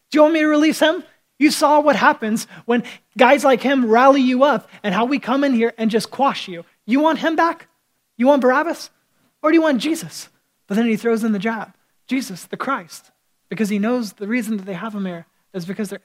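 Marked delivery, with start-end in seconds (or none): 0.1-10.3 louder, higher, goading governor; Pilate taunting the crowd. Retold or imitated. Preacher voicing Pilate to crowd.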